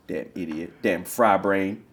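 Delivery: usual speaking voice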